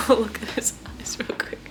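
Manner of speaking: hushed whisper so the kitten stays calm. Real, not acted. Whispering while holding kitten.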